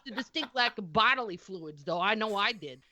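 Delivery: put-on light Boston accent, swearing as she reads. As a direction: reads the stats as a cranky Boston local. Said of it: "in the voice of a heavy Boston accent".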